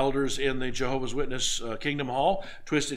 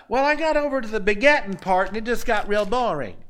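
high, louder lame excuse; mock excuse-making; 7 semitones higher, 5 dB louder than usual. Believer excusing himself before Christ, mock excuse-making. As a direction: play a believer whining out an excuse. Acted excuse at the judgment seat.

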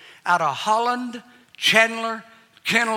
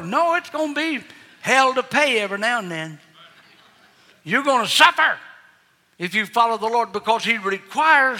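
louder warning from the pulpit; preaching; 3 semitones higher, 3 dB louder than usual. Acted. Preacher warning the congregation, raised and forceful. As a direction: drive the warning home.

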